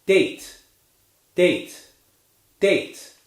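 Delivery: "date" with a clear gliding A diphthong; slow teacher model of "date"; model word date. Shown as a demonstration. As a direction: demonstrate "date", vowel gliding into ee. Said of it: "let's try a few words now".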